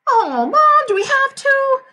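put-on high, loud kid's whine; whining protest. Peter objects to watching Fudge, high loud whine. Boy protests babysitting to mother.

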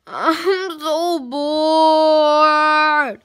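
loud, very drawn-out whine; hammed-up bored moan; exaggerated boredom. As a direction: stretch it out into a long bored wail. Acting bored over homework in skit.

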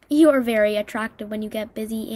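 ordinary delivery

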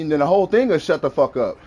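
ordinary talk, nothing marked

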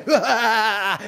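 giddy cackle of triumph; mad scientist laugh. Acted. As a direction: laugh like the experiment just worked. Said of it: "Acabei me empolgando um pouco". (risada de cientista louco)

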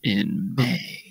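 secretive whispered aside. Pretend. Teasing whisper.

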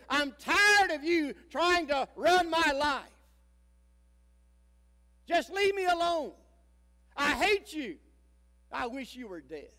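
high, loud, furious yell at her mother, dropping at the end; a rebellious daughter screaming at her mother; teen yelling. Retold or imitated. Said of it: "she yelled at her mother".

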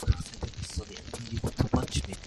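breathy whispered name. Acted. Lara whispers his name.

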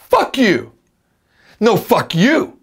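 high, punchy mock-angry retort; acted movie quarrel. Teacher acting out lines to copy, mock-angry. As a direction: trade insults like a film actor. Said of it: "真似してください".

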